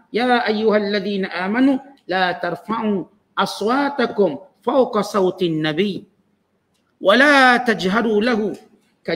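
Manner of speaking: slow Arabic recitation with long-held vowels; Quran recitation. Real, not acted. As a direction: recite the verse slowly and deliberately.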